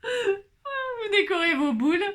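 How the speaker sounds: high, slow, squeaking through tears of laughter; can barely get the words out for laughing; laughing hard. Real, not acted. She corrects herself while helpless with laughter. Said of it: "n'importe quoi".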